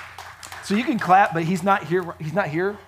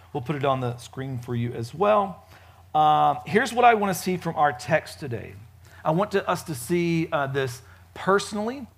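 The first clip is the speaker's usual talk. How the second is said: usual speaking voice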